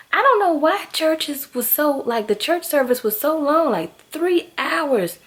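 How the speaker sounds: high, exasperated disbelief. Real, not acted. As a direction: drag out the three hours in disbelief. Exasperated about long church service.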